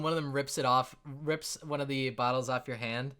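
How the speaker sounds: usual speaking voice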